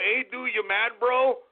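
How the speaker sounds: ordinary delivery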